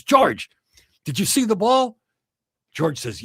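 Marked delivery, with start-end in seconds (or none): 0.0-2.0 eager golfer. Acted. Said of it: "And he says".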